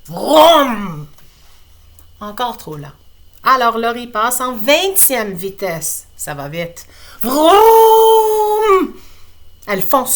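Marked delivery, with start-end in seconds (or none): 0.1-1.1 loud engine vroom sound. Acted. Girl speeding off in her wheelchair, loud vroom. 7.2-8.9 long, high, loud vroom; high engine vroom. Acted. Laurie at twentieth speed, high loud vroom. Wheelchair goes even faster.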